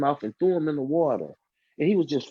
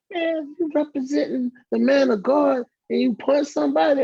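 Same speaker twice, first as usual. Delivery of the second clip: scolding brother; 10 semitones higher, 4 dB louder than usual. Retold or imitated. Brother Terrence chastises him.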